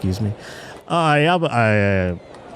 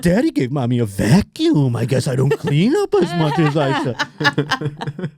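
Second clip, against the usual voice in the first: slow, put-on pet-parent voice; mock sweet coo with a barb in it; passive-aggressive baby talk; slower than usual. Wife complaining to the dog about a gift, passive-aggressive baby talk. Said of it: "in a very passive aggressive way".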